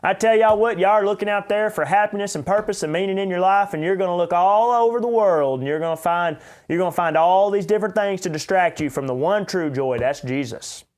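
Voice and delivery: mock revival preacher in full swing; jolly preacher preaching. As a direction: belt it out like a jolly country pastor. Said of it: "jolly fellows".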